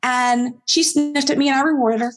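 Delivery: normal talking voice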